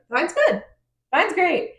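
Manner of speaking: lightly squeaky, upbeat quote; high-pitched acted reply. Acted quote of Cassian's quick reply.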